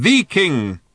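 loud shout pitched high on THE; high, forceful correction. A narrator's reading. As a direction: yell it emphatically. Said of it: "shouted old Thrashbog".

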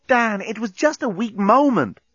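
high-pitched, protesting female voice; narrator's high Lucy voice; exasperated woman. A narrator's reading. Lucy pleading in a quarrel.

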